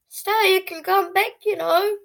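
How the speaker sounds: high sweet call to the dog; coaxing dog. Retold or imitated. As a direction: coax the dog back, pleading.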